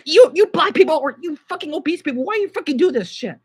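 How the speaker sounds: loud, high-pitched angry rant; real upset, shouting accusations; upset outburst. Real, not acted. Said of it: "I'm upset".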